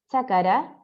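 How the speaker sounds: "chácara", extra a between k and r, slow; drawn-out "chácara", cha-ca-ra; inserted vowel. Shown as a demonstration. Teacher demoing the wrong form of chakra. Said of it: "en vez de decir chakra".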